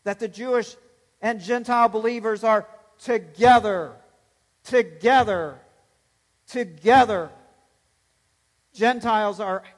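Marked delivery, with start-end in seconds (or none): 1.2-7.3 louder "together, together, together", each hammered home; thundering emphasis. Shown as a demonstration. Preacher driving home Paul's point, louder each "together". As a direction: pound each "together" harder. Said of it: "Paul is thundering home his point".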